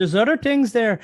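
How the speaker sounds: put-on Irish accent, genie; Irish-ish accent. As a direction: tweak the Wisconsin into Irish. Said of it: "it's an Irish accent".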